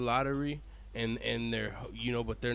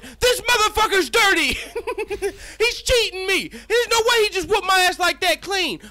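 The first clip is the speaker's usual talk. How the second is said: much higher, louder laughing yell; furious mic shout; 8 dB louder than usual. Pretend. Shouting to the crowd that his opponent cheated, much higher and louder. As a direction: scream it into the mic in outrage. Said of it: "grabbed the mic and said".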